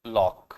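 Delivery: लॉक with ऑ between आ and ओ; half-open lock. Shown as a demonstration. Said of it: "लाक नहीं बोल सकते". (बीच वाला लॉक)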